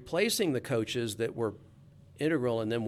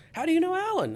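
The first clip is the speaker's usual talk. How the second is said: much higher, suddenly interested; surprised; 4 dB louder than usual. Retold or imitated. Manager surprised to hear of the reporter's uncle, surprised. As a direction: sound caught off guard and curious. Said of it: "his countenance changed completely".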